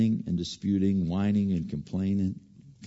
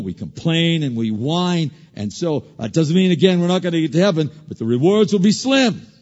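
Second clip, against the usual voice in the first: preaching; 9 semitones higher, 10 dB louder than usual. Acted. Preacher crying out scripture to the congregation, loud. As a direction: drive the scripture home.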